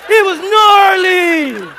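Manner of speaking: slow, high, loud stretched "gnarly" with a laugh; milk the word like a stoked dude; drawn-out mock surfer. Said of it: "a brother talking about".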